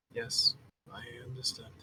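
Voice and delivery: very soft mumble under the breath; mumbled reply. Acted. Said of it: "As he mumbles it".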